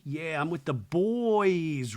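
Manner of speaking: slow, drawn-out bro imitation; mock bro, lingering on the words; one of the boys. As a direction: stretch it out like a mock lad. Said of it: "Craig's in his, like, boy outfit".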